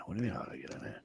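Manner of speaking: breathy whispered retort; whispered aside. Retold or imitated. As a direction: whisper it as a private grumble. Inner retort to the warden.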